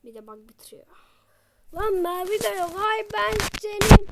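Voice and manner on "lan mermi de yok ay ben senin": much louder, higher angry outburst; outraged shout. Real, not acted. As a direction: explode at the game. Out of ammo, outraged.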